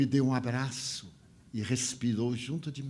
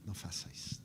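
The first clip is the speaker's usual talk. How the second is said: unvoiced, much softer "não faça isto"; whispered plea; 5 semitones lower, 14 dB quieter, faster than usual. Speaker begging the kneeling man in a whisper. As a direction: breathe the words as a hushed plea.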